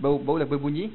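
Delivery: usual speaking voice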